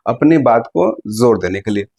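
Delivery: ordinary talk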